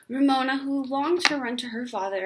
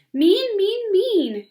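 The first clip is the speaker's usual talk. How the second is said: much higher, louder, chanted in time to the kicks; yelled in rhythm; 11 semitones higher, 9 dB louder, much slower than usual. Acted. Ramona to her family, yelling in time with her heels. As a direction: hammer each word in rhythm. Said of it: "she yelled in time".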